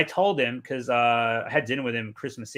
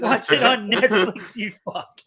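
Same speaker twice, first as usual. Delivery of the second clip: laughing scold; 8 semitones higher, 6 dB louder, slower than usual. Retold or imitated. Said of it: "I facepalmed".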